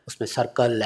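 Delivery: normal talking voice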